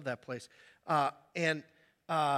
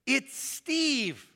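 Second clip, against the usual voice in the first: a higher, put-on "It's Steve"; mock visitor; 6 semitones higher than usual. Pastor playing the late visitor, put-on voice. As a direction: say your name hopefully through the door. Stranger giving his name.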